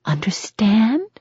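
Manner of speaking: slow menacing whisper. A narrator's reading. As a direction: breathe it out as a slow threat. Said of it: "in a voice just above a whisper".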